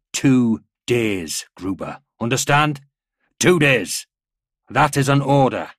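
loud, emphatic military command; stern order. A narrator's reading. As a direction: drive the order home to a subordinate.

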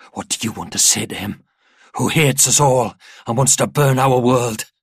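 building to a loud, fervent challenge; goading demand. A narrator's reading. Father asks what she will say to him, building to loud fervour. Father goads child to defy enemy.

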